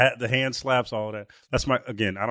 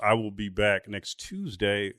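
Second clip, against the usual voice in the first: ordinary talk, nothing marked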